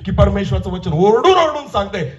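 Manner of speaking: high cry easing as it goes; fervent preaching. Real, not acted. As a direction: start at a high exhorting pitch, gradually come down. Preaches at full force. (चढ्या आवाजात सुरू होऊन उतरणारं प्रवचन)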